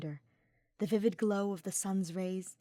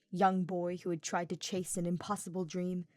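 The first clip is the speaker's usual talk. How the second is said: plain reading voice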